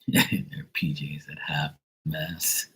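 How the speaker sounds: slow, breathless giggling whisper; whispered laugh. Real, not acted. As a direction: whisper it through your own laughter. Whispered joke through laughter.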